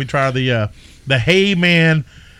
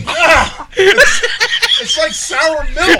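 disgusted laughing, high-pitched; 12 semitones higher, much slower than usual. Real, not acted. Disgusted after tasting sour beer.

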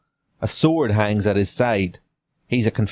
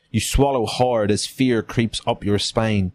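ordinary delivery, nothing marked

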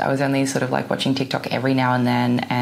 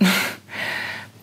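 soft laugh; 4 dB quieter than usual. Real, not acted. Laugh after whispered boast.

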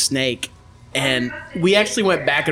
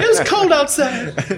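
mock whiny girlfriend; 11 semitones higher, slower than usual. Voicing girlfriend's complaint, laughing.

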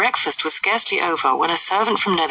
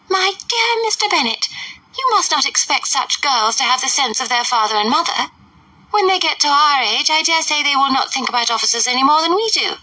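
high, fluttery mother's voice; pitched-up huffy matron; fussy Mrs Bennet; 7 semitones higher than usual. A narrator's reading. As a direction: play the indignant silly mother.

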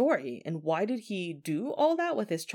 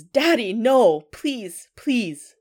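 loud, higher mock-panicked cry; retold scream; 6 semitones higher, 8 dB louder, much slower than usual. Son begging his father in the elevator, mock scream. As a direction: cry out like a terrified child, short of screaming. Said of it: "his son screams".

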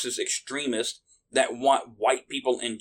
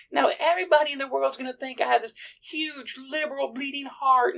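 much higher, softer, prissy mock-liberal voice; sneering parody of a smug activist, pitched way up; mocking smug protester voice; 3 dB quieter than usual. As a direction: lampoon a self-satisfied virtue signaller in a high, soft voice.

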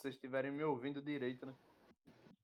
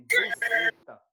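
high, loud yelled answer; shouted punchline; 12 dB louder, much slower than usual. Pretend. (resposta gritada)

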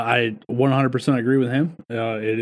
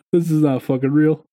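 normal talking voice

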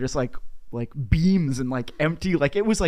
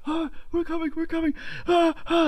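put-on high, strained old voice calling from far off; a panicked old man calling while running, much higher; old couple calling out. Old man running to the gate calling to staff, high and strained.

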